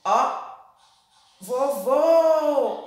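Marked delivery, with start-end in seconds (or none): 1.4-2.9 "vovô" loud and high, long final VÔ; calling out the word. Shown as a demonstration. Teacher shows pupils the long syllable in "vovô", calling out. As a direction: call out "vovô", stretching the second syllable. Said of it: "A segunda sílaba foi a sílaba que mais se alongou".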